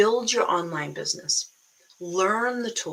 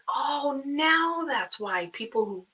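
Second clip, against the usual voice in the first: slow, drawn-out "oh, now"; dawning realization; 6 semitones higher, slower than usual. Retold or imitated. Coach reliving her accent discovery, drawn-out mock realization. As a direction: act out the penny dropping. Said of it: "I'm like".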